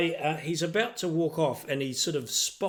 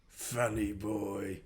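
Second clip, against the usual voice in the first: slow, lower, softer contemptuous sneer; dismissive lord; 4 semitones lower, 6 dB quieter, slower than usual. Acted. Lord sneers at the joking player, slow and low. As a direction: drop it coldly as you turn away. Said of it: "looks over at you and goes".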